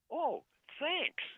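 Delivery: very high, loud, delighted alien imitation; cheerful alien. Alien takes the offered CD, high cheerful imitated thanks. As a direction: squeal thanks as the chirpy alien. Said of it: "that's how he talks".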